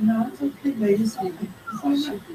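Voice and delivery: soft, soothing acted reply; hushed reassurance to defuse a quarrel; soft and nice. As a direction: play gently placating a partner. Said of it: "you talking soft and nice".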